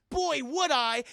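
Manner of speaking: high, loud eager cry; eager shout. Retold or imitated. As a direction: jump at the offer like a keen rookie. Eager cop reply.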